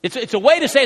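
ordinary talk, nothing marked

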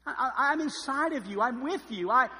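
reminding you he lives inside you; voicing the Holy Spirit.

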